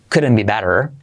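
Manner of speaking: American tapped t and full r; relaxed American couldn't be better; American accent. Shown as a demonstration. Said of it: "pero intenta no decir".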